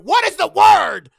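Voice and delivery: loud, high frustrated shout, slow; a slow, frustrated cry for the missing word. Real, not acted. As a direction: yell it out at yourself, stuck for a word. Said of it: "I can't think of it".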